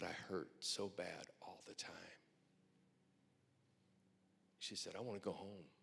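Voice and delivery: quiet, solemn voicing of a dying woman; softly retold pain. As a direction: let it drop to a hush.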